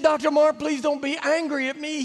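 high, timid pleading; apologetic guide pleading with the boss; pleading Carlos. Retold or imitated. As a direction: beg him not to be angry.

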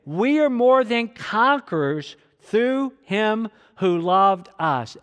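slow, emphatic read-along delivery; drawn-out, deliberate recitation; leading group reading. Real, not acted. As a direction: lead everyone through it slowly and firmly. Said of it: "Read it again".

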